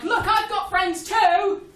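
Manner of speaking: loud, high, slow mock shout; bully's shout. Russia as playground bully yelling at other countries, hammed-up. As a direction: yell it like a taunting playground kid. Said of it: "being like".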